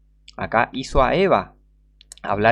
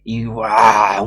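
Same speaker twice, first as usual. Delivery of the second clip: mock monster roar; 8 dB louder than usual.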